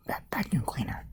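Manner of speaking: very soft, breath-only explanation; whispered aside. Pretend. Reader names what upset the pig, whispering. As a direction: whisper it to the listener.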